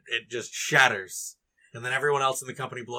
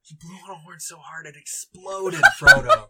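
higher, laughing tall-tale teller; hammed-up astonished hobbit; drunk, amazed Merry; 4 semitones higher, 8 dB louder than usual. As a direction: insist on the wild story, bursting at the name. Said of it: "Merry's just like".

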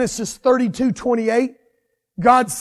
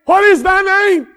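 commanding angel; 8 semitones higher, 5 dB louder than usual. Acted. Angel questions Jacob.

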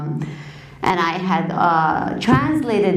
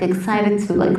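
normal talking voice

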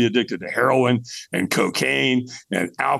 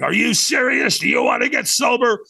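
high-pitched confrontational mock challenge; brutal, in-your-face; 12 semitones higher than usual. Hotline lady challenging a drunk caller, brutal and in his face. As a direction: imitate a tough gatekeeper getting in his face. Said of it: "She was brutal".

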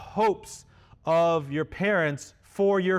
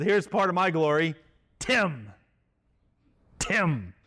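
mock proclamation. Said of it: "I sound ridiculous".

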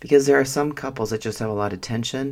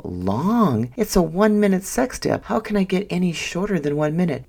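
much higher, disbelieving retort; incredulous protest; 9 semitones higher than usual. Retold or imitated. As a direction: protest in mock outrage that a minute is too long. Retold argument about episode length.

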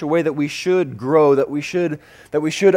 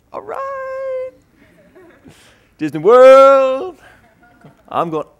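high-pitched, drawn-out cheer; elated kid; 9 dB louder, slower than usual. Acted. Boy celebrating his first Disney World trip, elated. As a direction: squeal it, then belt out a long, high whoop.

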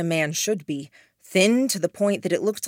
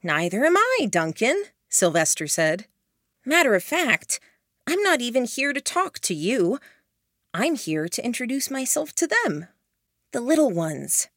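put-on higher, cocky young man; young man's voice; 6 semitones higher than usual. Sylvester taunts Duncan, higher cocky young voice. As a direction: tease him lightly from behind the smoke.

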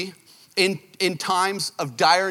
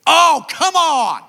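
exasperated outburst; 10 semitones higher, 7 dB louder, slower than usual. Retold or imitated. Cut the wrong wire, exasperated.

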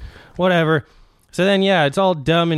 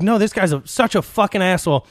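retold indignation in a higher pitch; fired up; 6 semitones higher than usual. Friend correcting him about the celebrity, retold with heated indignation. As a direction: imitate a worked-up friend insisting, higher. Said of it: "she fucking lost it".

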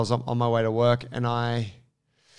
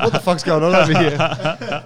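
bewildered onlookers; 5 semitones higher, 7 dB louder than usual. Retold or imitated. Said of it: "everyone's like".

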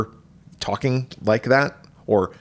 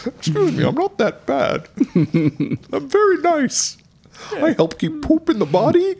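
high prissy squeak for a talking sphincter; high offended sphincter voice. Pretend. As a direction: play an offended sphincter, pitched way up. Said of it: "Some of our sphincter was like".

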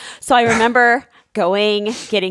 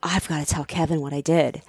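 quiet storyteller dread; 8 semitones lower, 7 dB quieter than usual. Retold or imitated. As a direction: lower and softer, sinking dread. Dread while retelling.

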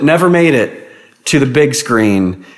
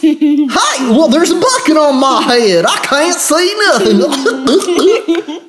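very high, drawn-out sitcom hillbilly holler; Dukes of Hazzard character; much slower than usual. Acted. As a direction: ham up a high-pitched country yokel. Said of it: "Dukes of Hazzard character or something".

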